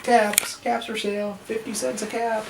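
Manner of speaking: high, singing-out street seller's call; calling out wares. A narrator's reading. Peddler calls as he went along, calling out. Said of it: "he called".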